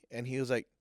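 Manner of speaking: usual speaking voice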